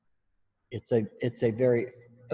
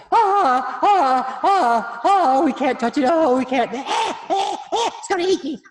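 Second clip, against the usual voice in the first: much higher, louder frantic voice; mock spider panic; 11 dB louder, slower than usual. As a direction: ham up a phobic patient. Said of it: "They go".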